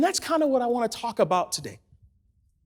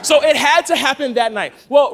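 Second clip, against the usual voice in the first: normal talking voice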